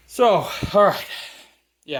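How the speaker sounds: panting a little between words; winded, breath still short. Real, not acted. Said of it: "I'm so out of breath".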